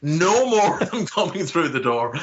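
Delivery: 笑いながら高めの声で言い切る; laughing mock boast. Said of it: "So I was like".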